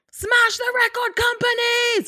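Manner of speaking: high, rousing protest cry; rally the crowd against the labels; fiery revolutionary speech. Pretend. Mock revolutionary slogan shouting.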